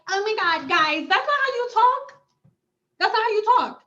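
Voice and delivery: high mock-polite chiding; mock YouTuber. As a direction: put on a prissy, scolding influencer voice. Mimicking a prim YouTuber addressing viewers.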